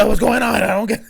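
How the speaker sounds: sudden worried panic; panicked parent. Retold or imitated. Mother reliving panic when baby cries too long, alarmed. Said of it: "you're like".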